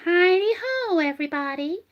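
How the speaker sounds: a lightly voiced cheery Mr Hankey; a brief high hint of the catchphrase. Doing Mr Hankey for the thumb puppet, lightly high. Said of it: "if it's gonna be Mister Hanky".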